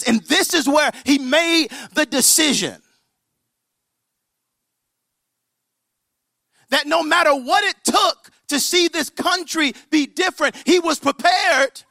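high, loud, ringing declaration; full-force preaching. Retold or imitated. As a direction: proclaim it to the whole hall.

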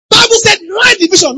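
high, loud preacher's shout; ringing scripture quote; forceful proclamation. Real, not acted. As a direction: proclaim the verse at full force. Preacher proclaims a verse at full force.

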